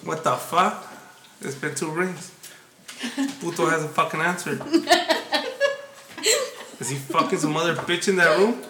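low, soft inner-voice grumble; Esme's inner thoughts. Pretend. Girlfriend wondering why he won't answer, low and muttered. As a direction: mutter it as jealous girlfriend thinking. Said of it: "Esme's thinking".

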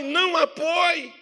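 firm shout. Real, not acted. Preacher rebuking the listener.

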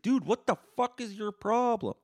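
very high, loud outraged yell; angry shout. Retold or imitated. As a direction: shout it at the puncher. Crowd yells at him.

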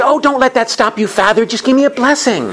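high, pleading, gushing church lady voice; overly pious woman. Retold or imitated. Pious woman begging the seminarian for a blessing, high and gushing. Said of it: "And the woman says".

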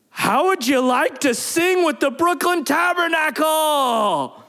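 high, drawn-out showman's call; big stretched-out offer to the crowd; announcer-style invitation. Retold or imitated. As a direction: imitate a pastor's grand announcement, slow and high.

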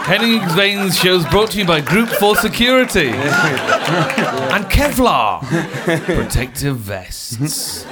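mock sponsor read. Host jokes Henning needs security, mock advert. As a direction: announce it like a radio ad, laughing.

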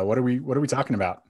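ordinary talk

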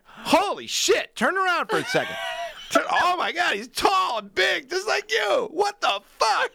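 hammed-up disbelieving shout; mock amazed shout. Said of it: "he'd stop and be like".

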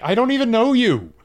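high, loud mock disbelief; mock incredulous. Greg rebuffing the girl at his door, loudly incredulous. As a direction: imitate an exasperated, incredulous protest. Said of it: "he rightly is like".

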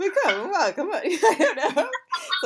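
laughing mock vampire line. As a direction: giggle through the vampire voice. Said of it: "like an Italian, stereotypical".

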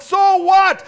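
a huge high yell on so what; so what, shouted out. Acted. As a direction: fling so what at the crowd. Shouted climax of the sermon.

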